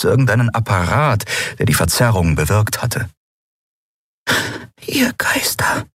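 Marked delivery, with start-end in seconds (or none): 4.9-5.9 a breathy whisper, barely voiced; breathed in awe. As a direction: breathe the words out in wonder. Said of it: "hauchte Nelen".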